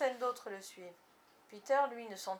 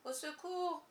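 higher, faint call for help; faint cry for help; 7 semitones higher, slower than usual. A narrator's reading. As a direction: call out weakly from the water. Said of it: "un faible cri s'éleva". (faible appel au secours, plus aigu)

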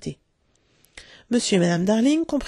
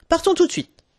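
much higher, louder, raring to go; eager shout; 10 semitones higher, 5 dB louder than usual. A narrator's reading. John grabbing his Sunday hat, shouting to leave now. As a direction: burst out with boyish eagerness.

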